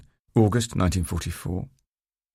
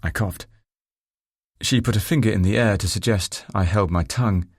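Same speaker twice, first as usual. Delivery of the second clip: ordinary delivery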